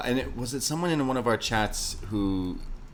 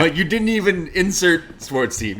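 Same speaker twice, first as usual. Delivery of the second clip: loud, high, exasperated complaint; 8 semitones higher, 10 dB louder than usual. Real, not acted. Fan ranting to co-host about a lazy promo, loud exasperation. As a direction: vent at how lazy the line was.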